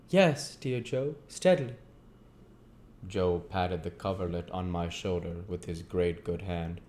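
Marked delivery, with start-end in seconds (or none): 0.1-1.8 reassuring youth; young Pip voice. Acted. Pip answers Joe.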